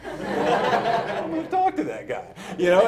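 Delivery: slow, loud laughing line. Real, not acted. Deciding to befriend Justin, laughing. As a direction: laugh out the decision.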